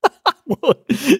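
short high-pitched laugh; amused high giggle. Real, not acted. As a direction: burst out laughing in reaction. Reacts to the second quote.

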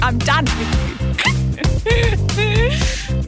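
ordinary talk

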